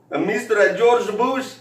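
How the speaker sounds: high, slow mock Mexican accent. Drunk boss tells Ro about the wall, high and slow in a Mexican accent. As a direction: ham up the boss's accent, drawn out. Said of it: "He said".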